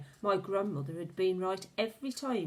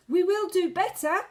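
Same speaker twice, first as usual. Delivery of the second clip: ordinary delivery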